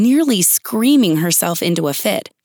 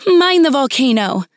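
raised, higher-pitched cry of warning; calling out; 6 semitones higher, 4 dB louder than usual. A narrator's reading. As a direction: call a warning across the room.

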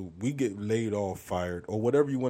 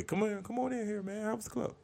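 high-pitched friendly voice; 13 semitones higher than usual. Retold or imitated. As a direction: welcome her in sweetly. Voicing friendly headliner.

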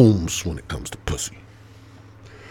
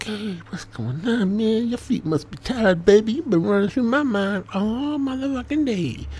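smooth pickup voice. Retold or imitated. Man sweet-talking a girl he just met, cheesy pickup voice. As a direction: lay on the cheesy charm. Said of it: "You're like".

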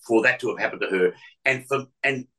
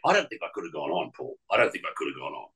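quiet regret; 6 semitones lower, 6 dB quieter, faster than usual. Real, not acted. Confessing painful regret.

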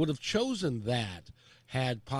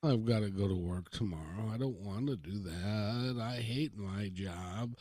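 mock weary complaint; 6 semitones lower, 6 dB quieter than usual. Imitating reluctant worker.

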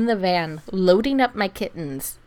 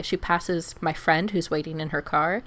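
ordinary talk

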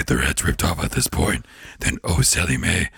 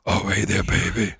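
a guttural Satan; throaty voice; 4 semitones higher, 5 dB quieter than usual. Shown as a demonstration. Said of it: "guttural and throaty".

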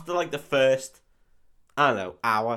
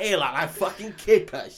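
laughing Cockney thug; 7 semitones higher, 5 dB louder than usual. Retold or imitated.

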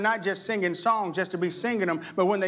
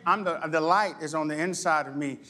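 ordinary delivery

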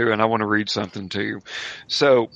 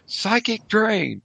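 high, drawn-out cry with a falling end; 10 semitones higher, much slower than usual. A narrator's reading. Game master voices a group casting at rivals, crying out. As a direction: call it out slow and high, like a curse. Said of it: "and cry out".

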